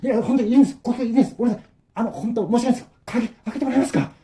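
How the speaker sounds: high, pleading apologies; anxious begging. Acted. Trapped friends beg the old man again, anxiously. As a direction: beg him, sorry and urgent.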